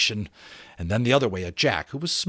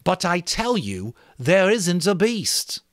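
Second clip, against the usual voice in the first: insistent; 8 semitones higher, 4 dB louder, slower than usual. Acted. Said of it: "to make the point, loudly and again".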